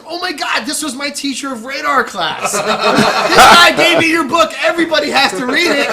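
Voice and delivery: high, loud, laughing rave; starstruck gush. Retold or imitated. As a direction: rave like a fan who just found out. Trooper gushing over his book.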